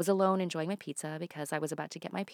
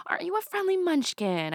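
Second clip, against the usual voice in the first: put-on high, louder baby-talk coo; cooing at a toddler; 10 semitones higher, 4 dB louder than usual. Sitter cooing up at a friendly toddler in her arms. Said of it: "I said to him".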